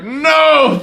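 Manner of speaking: high, loud yelled No; mock outburst. Friend imagined yelling No over popcorn. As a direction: throw it out like a tantrum. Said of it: "he's like".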